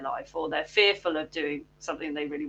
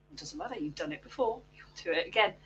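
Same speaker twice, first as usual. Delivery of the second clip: reassuring inner voice; 9 dB quieter, faster than usual. Acted. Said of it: "Training that little voice to say the useful stuff".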